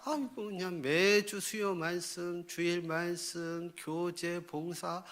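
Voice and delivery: slow, softened, droning list of chores; weary grumbling. Acted. Believer moans about weekly church duties, weary grumbling. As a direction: drag through each duty wearily.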